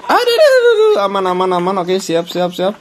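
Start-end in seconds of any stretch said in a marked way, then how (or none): 0.0-1.1 panicked yelp. Real, not acted. Sudden jump scare.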